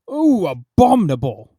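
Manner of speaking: a loud growl of disgust. A narrator's reading. Royal highness growling at the nose, loud. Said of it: "growled His Royal Highness".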